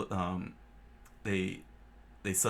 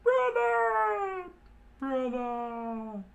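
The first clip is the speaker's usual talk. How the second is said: far higher, calling out across water; 5 dB louder, much slower than usual. Acted. Johnny and Yosaku calling to Solo, high-pitched. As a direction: cry out for help from the waves. Brothers call from the sea.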